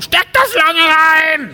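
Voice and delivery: very high, slow, drawn-out mock yell; mock shout from next room. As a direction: ham up the call from the next room. Freund beim Zocken nachgemacht. (gedehnter, hoher Spottruf)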